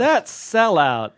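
mock outrage.

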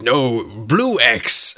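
slow, stretched giant retort; a mock giant argument, not really yelled; bickering giant. Game master plays giants bickering over rocks, slow giant voice. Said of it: "they're just yelling at each other".

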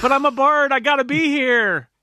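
high-pitched, indignant wail; hammed-up pleading bard; loud in-character protest. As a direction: protest loudly, voice shooting up high. Cool Cat protests leaving the tavern.

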